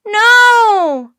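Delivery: very loud, high screamed protest; long full-voiced high scream. A narrator's reading. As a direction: scream it out in horror. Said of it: "screamed Jack and Annie".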